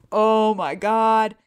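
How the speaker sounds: drawn-out, loud, high groan of dread; retold desperation. Patient bartering with scanner staff, exaggerated desperation. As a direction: stretch it out in mock despair.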